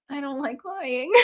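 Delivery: softly, holding back a giggle; deadpan honest Nam. Retold or imitated. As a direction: say it as a simple fact.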